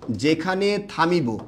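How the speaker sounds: slow, measured words for note-taking; slow dictation. Shown as a demonstration. Teacher dictating a rule to students, slowly.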